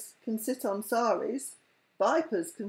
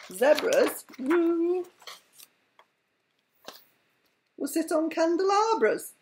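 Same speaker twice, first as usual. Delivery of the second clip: triumphant zebras; 7 semitones higher, 4 dB louder, slower than usual. Shown as a demonstration. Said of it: "just to prove I can think of a Z".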